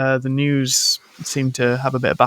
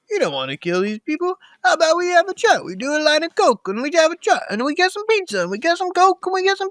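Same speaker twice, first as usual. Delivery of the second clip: much higher, fast smarmy salesman patter; hammed-up Ellis; 12 semitones higher, faster than usual. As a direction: schmooze like a slick coked-up businessman. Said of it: "he's just doing lots of this".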